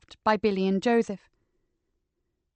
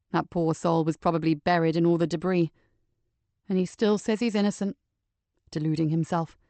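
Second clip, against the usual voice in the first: a lower man's voice, steady and grim; Joseph, calm low detective; 4 semitones lower than usual. Acted. Joseph explaining the second body to Nicky, steady and low. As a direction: speak as Joseph, level and sure.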